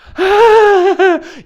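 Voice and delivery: loud, high forced cackle; mocking fake laugh. Customer answering the drive-thru lady, mocking forced laughter. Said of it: "like, a little chuckle".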